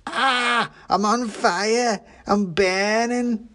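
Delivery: high, drawn-out cries of alarm; panicked pudding shouting. A narrator's reading. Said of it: "shouted the pudding".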